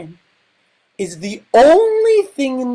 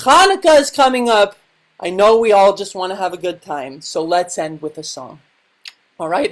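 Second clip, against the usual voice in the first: ordinary talk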